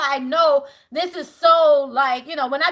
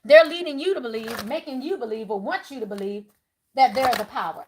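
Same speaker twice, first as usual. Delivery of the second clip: usual speaking voice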